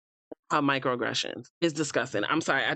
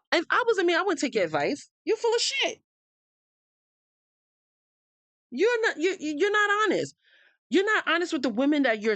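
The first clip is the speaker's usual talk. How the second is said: pitched way up, half laughing, half crying in disbelief; outraged rant; 11 semitones higher than usual. Real, not acted. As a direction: let the outrage spill over into a shaky high laugh. Heated rant at a contestant.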